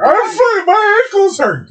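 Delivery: mock pain. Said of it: "You're just like".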